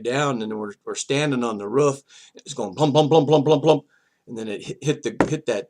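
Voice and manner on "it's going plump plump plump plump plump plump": loud, rhythmic plump plump; thumping sound effect. Real, not acted. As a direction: beat out each thump of the roof. Imitating bus rolling thumps.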